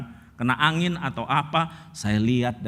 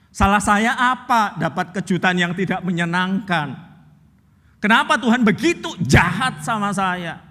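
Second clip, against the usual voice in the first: louder, higher accusing plea; woman accusing God, voice raised; distressed outburst; 6 semitones higher, 5 dB louder than usual. Retold or imitated. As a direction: hurl the questions at the pastor. Woman's outburst retold again.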